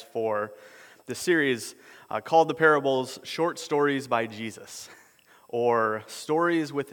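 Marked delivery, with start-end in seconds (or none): none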